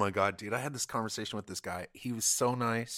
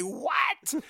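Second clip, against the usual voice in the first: much higher, incredulous what; stunned what; 4 dB louder than usual. Retold or imitated. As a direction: yelp it in disbelief. Stunned reaction to the guy.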